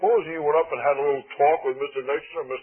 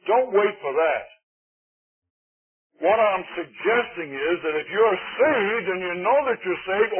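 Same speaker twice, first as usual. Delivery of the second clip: ordinary talk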